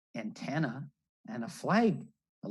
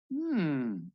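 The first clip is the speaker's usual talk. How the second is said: usual speaking voice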